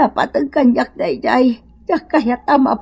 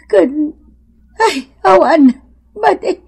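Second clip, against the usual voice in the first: loud, higher outburst of refusal; upset refusal; 3 semitones higher, 4 dB louder than usual. Acted. Mother loudly refusing her daughter's plan. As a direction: burst out refusing, voice rising.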